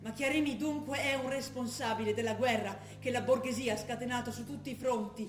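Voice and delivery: firm, declamatory proclamation over music; a revolutionary proclamation read aloud with conviction; militant communiqué reading. Acted. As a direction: read it like a manifesto. Reads Red Brigades communiqué.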